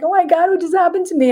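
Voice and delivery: high, stunned disbelief; reeling after the blindfold comes off; shocked. Retold or imitated. Teen shaken after the seance, high and stunned. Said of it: "I'm like".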